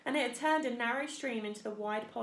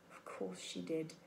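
muttering to himself; 3 semitones lower, 8 dB quieter than usual. A narrator's reading. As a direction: mutter it under your breath. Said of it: "Mister Beaver muttered to himself".

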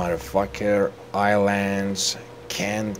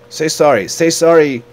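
high, loud, repeated order; mock angry demand; 8 semitones higher, 11 dB louder than usual. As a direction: demand an apology, loudly. Demands apology from game character.

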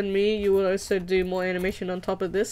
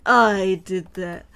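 evil villain voice. Pretend. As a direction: boast like a cartoon villain. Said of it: "I'm like".

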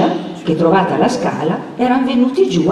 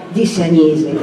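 usual speaking voice

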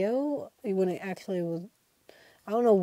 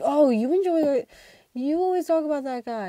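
higher mock friend voice, louder at first; teasing friend; 7 semitones higher, 5 dB louder than usual. Classmate mocking her for always talking about Joey, higher voice. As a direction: tease her about her idol.

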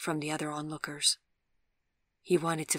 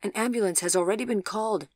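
raised, higher voice of a policeman; shouted announcement; 5 semitones higher, 6 dB louder than usual. A narrator's reading. Policeman running up to an accident scene, shouting out. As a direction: call the news out over the street. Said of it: "shouted one of the policemen".

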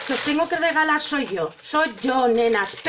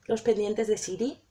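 quiet; 4 semitones lower, 7 dB quieter than usual. Real, not acted. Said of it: "no puedo evitarlo".

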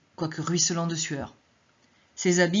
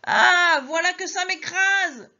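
high, loud pained shout, slow; crushed old man crying out; old man's cry; 12 semitones higher, 7 dB louder, slower than usual. Acted. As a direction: scream as the cart crushes him. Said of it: "cria le vieillard".

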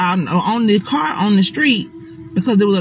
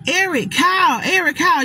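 high, calling names into the dark woods; calling out loud; 9 semitones higher than usual. Retold or imitated. Scared friend calling into the woods, high and urgent. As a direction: shout their names to the trees. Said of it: "we screaming loud".

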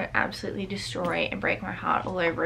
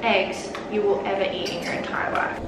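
ordinary delivery

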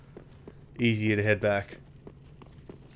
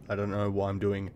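normal talking voice

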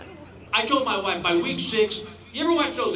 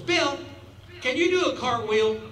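Emma asking; 7 semitones higher than usual. Retold or imitated. Emma asks, voiced higher.

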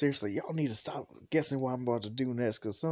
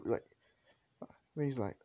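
usual speaking voice

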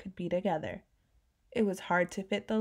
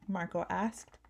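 plain reading voice

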